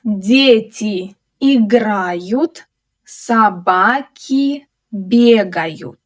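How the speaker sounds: slow, drawn-out model reading for learners. Shown as a demonstration. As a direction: stretch each word out for a learner to follow.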